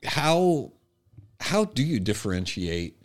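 usual speaking voice

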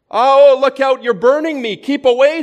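high, loud alarmed pleading; panicked cry. A narrator's reading. Man flinching back from the angel, panicked and loud. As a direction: recoil from the burning heat in alarm.

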